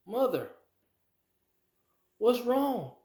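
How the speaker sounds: put-on high, worried little girl asking her mother; small frightened child calling to mother; a child woken by commotion on a plane; little girl's voice.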